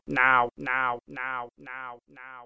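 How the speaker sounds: "now" repeated evenly, dying away; repeating echo fade-out; fading echo. Pretend. Echo fades out the last word.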